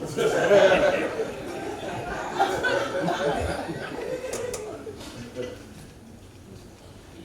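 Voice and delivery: breathless high laugh; cracking up at his own joke; high wheezing laugh. Real, not acted.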